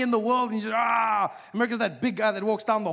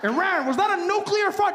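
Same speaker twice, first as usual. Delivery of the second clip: high alarmed shout; 11 semitones higher than usual. Pretend. America accuses Iran, high alarmed shout. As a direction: demand in alarm.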